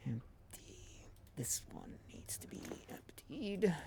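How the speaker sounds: whispered to self. Real, not acted. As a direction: murmur it under breath. Whispering to self while sorting.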